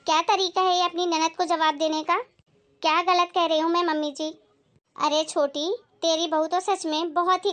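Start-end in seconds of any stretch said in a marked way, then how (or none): none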